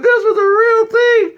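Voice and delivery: very high squealed imitation; high, hammed-up shriek; mock hysterical girls. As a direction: wail it like an upset girl. Mimics girls, then answers himself.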